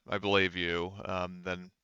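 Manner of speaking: usual speaking voice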